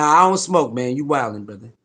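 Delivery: usual speaking voice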